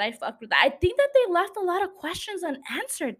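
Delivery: ordinary talk, nothing marked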